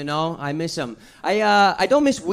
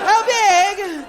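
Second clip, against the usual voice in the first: mock panic; 9 semitones higher than usual. Panicking after huge hit.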